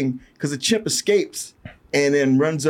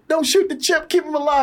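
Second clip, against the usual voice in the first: high, pleading cry to spare the chimp; 13 semitones higher than usual. Retold or imitated. Chris Brosnan begging soldiers to spare the chimp, high pleading voice. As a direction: squeak it out high, begging them. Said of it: "Chris Brosnan's going like".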